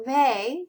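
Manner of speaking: lifted, emphatic "may"; stressed syllable alone. Shown as a demonstration. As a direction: punch "may" as the strongest syllable. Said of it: "is the strongest accented".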